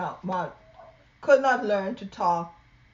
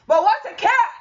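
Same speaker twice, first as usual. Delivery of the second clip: put-on loud, high child's answer; very loudly; 13 semitones higher, 12 dB louder than usual. Loud Mouse answering mama very loudly. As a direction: shout the reply. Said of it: "very loudly".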